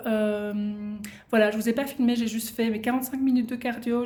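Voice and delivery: ordinary delivery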